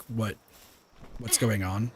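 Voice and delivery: normal talking voice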